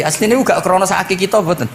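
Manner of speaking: normal talking voice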